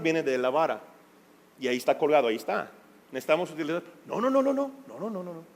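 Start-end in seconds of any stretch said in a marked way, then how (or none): 4.1-5.4 firm repeated no, high at first then fading very soft; mock parental no-no; scolding parent. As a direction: warn the toddler off, then let it die away. Acting parent scolding child.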